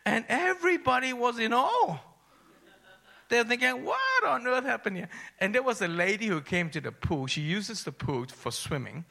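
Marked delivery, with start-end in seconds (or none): 0.1-6.8 high, loud testimony climax; raised, ringing preacher's delivery; full-force preaching. Real, not acted. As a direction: proclaim it to the whole church.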